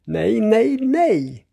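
high, drawn-out wail of protest; loud cry. A narrator's reading. Grandfather seeing hands washed in the eggs, crying out loudly. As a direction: cry out no in rising panic. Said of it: "Morfar skriker högt".

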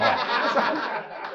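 breathy high laugh. Real, not acted. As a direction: laugh it off lightly. Preacher laughs at son's reply.

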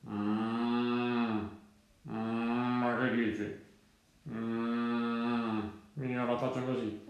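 low, mooing cow voice; storybook cow, long low hums. Acted.

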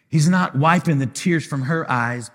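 ordinary delivery

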